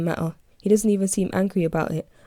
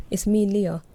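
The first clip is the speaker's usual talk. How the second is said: usual speaking voice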